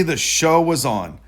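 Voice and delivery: plain reading voice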